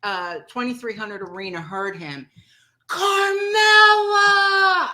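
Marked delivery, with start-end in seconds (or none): 2.9-4.9 long, high, loud scream of the name. Retold or imitated. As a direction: yell it to the whole arena at the top of your lungs. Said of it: "He screamed that the entire".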